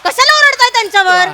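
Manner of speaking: indignant protest. Acted. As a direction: challenge them, pitch pushed up. Repeats the objection more forcefully.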